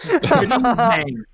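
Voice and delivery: high, slow gnome voice through a laugh. Acted. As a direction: play the gnome, laughing as you speak. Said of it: "I'll do the voice of the gnome".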